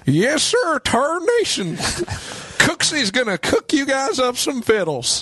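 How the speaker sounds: put-on very high, twangy old-timer; squeaky old prospector hollering. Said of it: "like the old-timey prospector".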